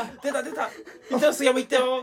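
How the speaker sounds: excited commentator. Pretend. Mock commentator cheering launch.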